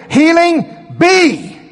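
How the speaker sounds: loud, high, drawn-out decree; high ringing command, stretched slow; commanding proclamation. Acted. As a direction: call healing into existence. Proclaims healing like creation command.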